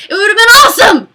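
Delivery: loud, high burst of enthusiasm; really excited. Real, not acted. Carried away imagining a console showdown, very excited. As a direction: gush at full volume, getting carried away. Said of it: "a little bit too excited".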